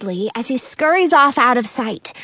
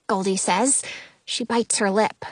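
plain reading voice

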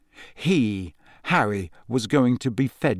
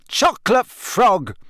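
higher, slow shouted password; frustrated shout, hopping on one leg; angry yell; 9 semitones higher, 4 dB louder, slower than usual. A narrator's reading. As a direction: yell it at the stone. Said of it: "he yelled angrily".

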